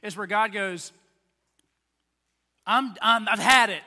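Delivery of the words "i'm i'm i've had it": loud, exasperated outburst; imitated exasperation, punched out loud; fed up. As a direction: act out running out of patience. God voiced, fed up.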